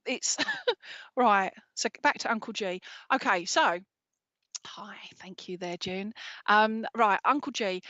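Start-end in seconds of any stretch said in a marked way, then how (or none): 4.7-6.1 whisper. Real, not acted. Aside thanks while reading.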